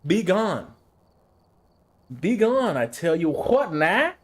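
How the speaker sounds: high, loud banishing cry, slowing at the end; hammed-up banishing yell; mock exorcist shout. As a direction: shout them away like casting out a demon. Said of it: "shine that flashlight at their face".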